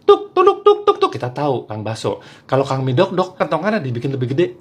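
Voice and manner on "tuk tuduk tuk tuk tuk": high, loud, sharp "tuk" taps; imitated meatball-cart knocking. Meatball vendor's signature knock, high percussive taps. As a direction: knock out a light wooden rhythm by mouth. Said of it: "Kang Baso ketokannya kan khas".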